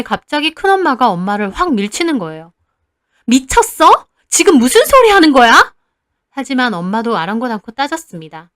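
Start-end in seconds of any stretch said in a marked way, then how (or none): 3.3-5.7 high, loud shocked scream; furious scream. Acted. Aunt shoves mother and screams at her, furious.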